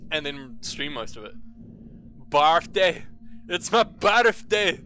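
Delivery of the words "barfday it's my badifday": loud, exaggerated northern-British "birthday"; weird northern accent. Streamer trying out birthday for chat, weird northern accent. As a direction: belt it out in a muddled Irish-Scottish-northern accent. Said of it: "that's like a weird fucking northern".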